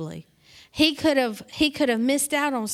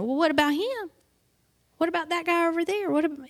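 higher-pitched imitated protest; deflecting protest; 6 semitones higher, faster than usual. Peter pointing at another disciple, higher imitated deflecting question.

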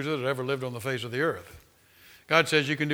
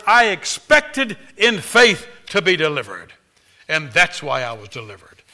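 full-force preaching; 3 semitones higher, 7 dB louder than usual. Acted. Preacher rousing his congregation with fervent loud preaching. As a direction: preach it out to the whole church. Preacher builds up about faith.

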